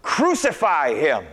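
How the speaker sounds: slow, high, loud crowd cry. Acted. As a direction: roar it out as a mob. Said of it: "they shouted all the louder".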